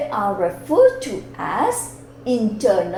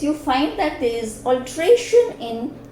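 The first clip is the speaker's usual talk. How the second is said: normal talking voice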